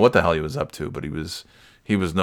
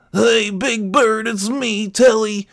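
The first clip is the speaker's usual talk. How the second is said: high, drawn-out puppet monster voice; Telly; 13 semitones higher, slower than usual. Retold or imitated. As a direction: hail a friend in a slow, high puppet voice. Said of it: "almost like Telly".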